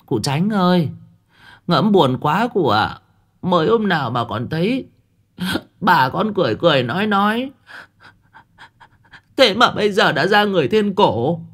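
high, ingratiating woman's voice; fawning village woman. A narrator's reading. Village woman flattering Chánh Biên at the funeral, high and fawning. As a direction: flatter the master with a mourning sigh. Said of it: "có giọng người phụ nữ trong đoàn đưa tang cất tiếng".

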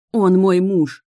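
loud, forceful declaration; emphatic outburst. A narrator's reading. Heroine struggling to hold the witch, emphatic outburst. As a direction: burst out with the confession.

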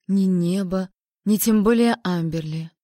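plain reading voice

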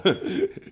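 loud, high amused laugh; hearty laugh. Acted. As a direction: laugh out at the finery. Bauer lacht über den Putz. (lautes, hohes Lachen)

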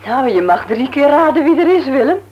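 wife calling her husband to guess the visitor. Acted. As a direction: call through the house. Wife calls husband from door.

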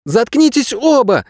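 high-pitched, drawn-out angry command; barked order. A narrator's reading. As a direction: snap at them both in a high voice. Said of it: "рявкнула девчонка".

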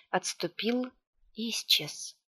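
ordinary delivery, nothing marked

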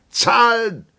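loud, high call. A narrator's reading. Captain calls for the bill, loud and high. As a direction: holler for the bill. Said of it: "ruft der Hauptmann".

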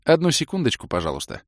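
bright polite receptionist; a sweet hotel clerk; light receptionist voice. Acted. (голос вежливой администраторши)

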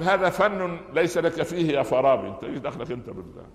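high, loud taunt; mocking dismissal. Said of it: "لما سخر من الفارابي".

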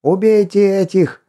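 high barked order; commanding shout. A narrator's reading. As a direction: bark the kill order. Leader orders the kill.

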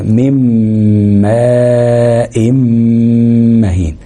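"ميم ماء مهين" with very long hummed m joins; merged meem. Shown as a demonstration. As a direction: slowly hold each doubled meem in "ميم ماء مهين" on a level pitch. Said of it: "هندغمها برضو في الميم".

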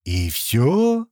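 mildly let-down question; somewhat disappointed. A narrator's reading. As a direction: ask with a touch of disappointment. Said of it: "как-то разочарованно спросил Слива".

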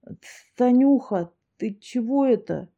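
put-on stammering, tongue-tied drunk; slurred drunk speech. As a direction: stumble over it, drunkenly puzzled. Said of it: "заплетающимся языком проговорил мужик".

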